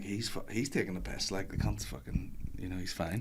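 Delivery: low hushed dismissive grumble; sceptical mutter. Retold or imitated. Colleagues doubting a sick man, muttered low. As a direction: grumble it under your breath. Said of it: "they'll be like".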